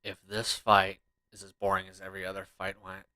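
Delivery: ordinary talk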